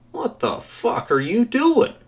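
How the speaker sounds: very high, incredulous outburst; indignant cabbie. A narrator's reading. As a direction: protest with pitch soaring to the end. Startled taxi driver objects to intruder.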